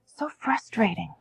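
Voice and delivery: slow, soft whisper. Shown as a demonstration. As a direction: breathe the words out slowly. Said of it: "when you say".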